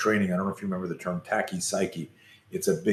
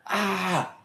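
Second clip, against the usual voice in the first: imitating a blood-curdling scream; 9 semitones higher than usual. Boy recalls the scream after the body punch, high mock scream. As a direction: replay the scream at a high pitch, held back in volume. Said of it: "I screamed this blood-curdling scream".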